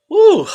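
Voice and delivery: loud high sneeze. Real, not acted. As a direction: let out a big sneeze. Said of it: "I had to sneeze".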